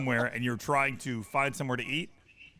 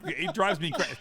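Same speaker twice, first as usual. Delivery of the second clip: ordinary talk, nothing marked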